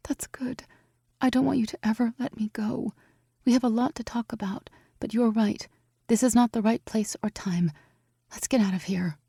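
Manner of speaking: high, soft, hushed; soft young woman. A narrator's reading. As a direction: murmur back tenderly. Evie voice, soft.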